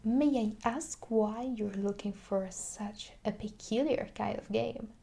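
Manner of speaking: usual speaking voice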